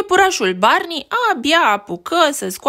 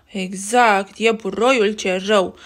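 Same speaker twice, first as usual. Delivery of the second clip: plain reading voice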